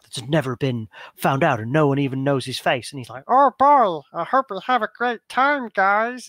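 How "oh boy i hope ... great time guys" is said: high, loud goofy cheerful voice; quirky voice. Shown as a demonstration. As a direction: go up high and bubbly for the goofy contact. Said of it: "really unique, quirky voice".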